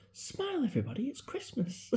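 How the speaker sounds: high, bright-voiced imitation of grandma; grandma's cheery order. Grandson mimics grandma ordering the sick family: high cheery call. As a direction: chirp it high like a cheery old gran.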